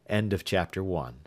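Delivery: usual speaking voice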